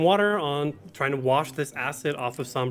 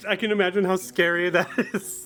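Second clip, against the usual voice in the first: real nervous giggles breaking up the words, higher pitch; nervous laughter; 5 semitones higher than usual. Real, not acted. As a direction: apologize while helplessly laughing from nerves. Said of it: "I'm laughing nervously".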